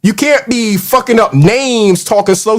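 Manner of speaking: ordinary delivery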